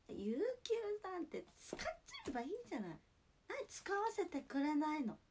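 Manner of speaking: swooping high exclamations, then dropping back down; snack-bar mama. Acted. Snack mama questioning a customer about paid leave, exaggerated hostess impression. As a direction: play an incredulous bar hostess with big pitch swings. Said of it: "スナックのママのモノマネ".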